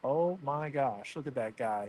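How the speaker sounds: normal talking voice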